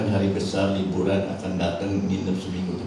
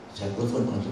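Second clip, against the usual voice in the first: ordinary talk, nothing marked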